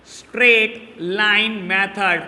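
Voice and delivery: "Straight line method" slowly, each word set apart; dictation. Shown as a demonstration. Teacher repeating the method's name to students, slow dictation.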